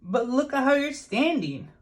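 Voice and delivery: high, light bird voice; Marabou voice. A narrator's reading. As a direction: point it out gently, pitched well up. Marabou points out Jim's posture.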